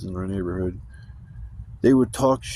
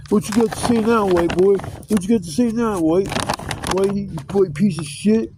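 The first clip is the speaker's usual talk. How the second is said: high, louder mock taunting; talking shit; 8 semitones higher, 3 dB louder than usual. Kids taunting him in the front yard, trash-talking imitation. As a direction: imitate a kid goading with a crowd behind him. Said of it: "talking shit".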